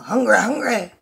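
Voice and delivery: high, loud playful call; playful sing-song. Acted. Mother playing the hippo game for her daughter, high and loud. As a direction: bounce it like a kids' game.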